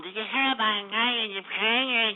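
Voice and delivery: garbled, put-on imitation of a caller; mocking caller's voice. Host aping the caller he just insulted, mocking imitation. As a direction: mimic the caller's silly voice mockingly. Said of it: "what's up with that voice?".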